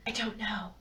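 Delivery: soft, barely voiced admission; whispered. A narrator's reading. Edith answering Bo, a soft whisper. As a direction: let it fall to a hush. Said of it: "she whispered".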